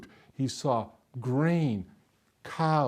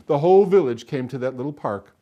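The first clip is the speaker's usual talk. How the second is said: usual speaking voice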